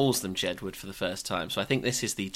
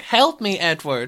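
mock plea; 8 semitones higher, 5 dB louder than usual. Mock plea opening clip.

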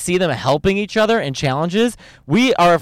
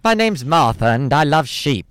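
British baking contestant. Retold or imitated. Mimicking a Bake Off contestant, placid British accent. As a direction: introduce yourself simply in a British accent. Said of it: "the British Bake Off's just like".

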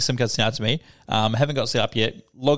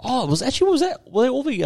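imitated surprised question, pitched much higher; mock surprise; 11 semitones higher than usual. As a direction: ask it high, as if puzzled by the news.

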